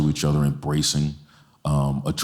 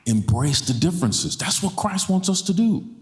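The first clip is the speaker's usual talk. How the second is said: impassioned preaching; 11 semitones higher than usual. Acted. Pastor pressing the church to embrace differences, impassioned preaching. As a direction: preach it home, each phrase climbing higher. Preacher builds to full force.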